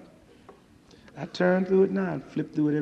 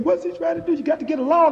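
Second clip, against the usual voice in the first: panicked public; 10 semitones higher, 7 dB louder than usual. Retold or imitated. The country's panic acted.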